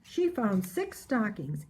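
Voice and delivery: ordinary delivery, nothing marked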